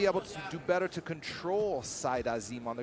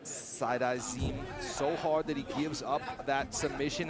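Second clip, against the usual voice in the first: ordinary delivery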